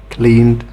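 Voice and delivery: "cleaned" ending in a voiced d. Shown as a demonstration. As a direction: pronounce "cleaned" as one syllable, cleand. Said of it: "the E D is pronounced".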